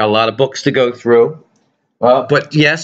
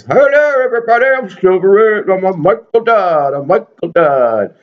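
high put-on puppet voice; a goofy figure introducing himself; 8 semitones higher than usual. The Michael Dodd figure greets viewers in a high puppet voice. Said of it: "There he is".

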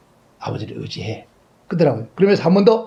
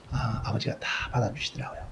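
whispered, much softer; 4 semitones lower, 13 dB quieter than usual. Real, not acted. Pastor recalling his father catching him, whispered. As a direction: hush it like a fond memory.

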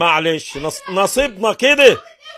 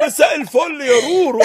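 ordinary talk

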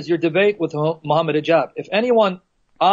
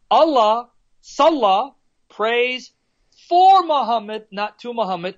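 raised, measured delivery leaning hard on "for"; emphatic word by word; 3 semitones higher, slower than usual. Shown as a demonstration. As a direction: spell it out with pauses, hitting "for". Spelling out the disputed argument.

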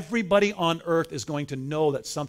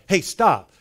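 loud, higher-pitched mock yell; retold yell; 4 semitones higher, 7 dB louder than usual. Metal detector lady yelling at a passing teenager, mock yell. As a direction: imitate a guard calling someone to halt. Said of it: "she's yelling kind of like".

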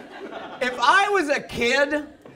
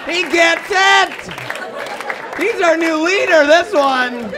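loud, high crowd cheer; rallying cheer; 5 semitones higher, 5 dB louder than usual. Acted. Parents and employees to the table-stander, cheering wildly. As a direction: holler it like hailing a new leader.

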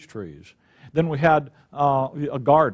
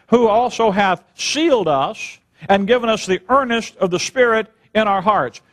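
scripture reading; 3 semitones higher than usual. Acted. Reading scripture aloud in sermon.

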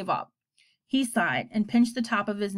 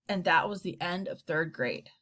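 ordinary delivery

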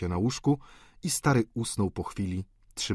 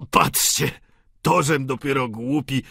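put-on loud self-reproaching old man; sudden realisation; 5 semitones higher, 7 dB louder than usual. A narrator's reading. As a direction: exclaim at one's own foolishness. Father scolds himself for forgetting.

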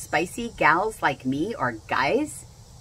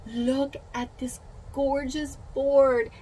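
gushing; 8 semitones higher, much slower than usual. Real, not acted. Admiring the board.

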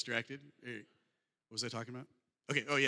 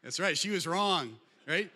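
triumphant; 5 semitones higher, 7 dB louder, slower than usual. Real, not acted. Triumphant punchline to audience.

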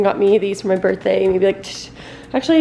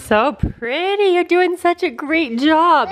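higher, slow sing-song praise; 7 semitones higher, slower than usual. Real, not acted. Praising toddler while he colors.

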